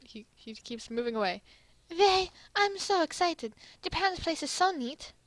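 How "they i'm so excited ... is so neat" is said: high, excitable Italy voice; excited Italy. Acted. Italy admires Japan's place, high and excited. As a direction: pitch it up, thrilled to be visiting.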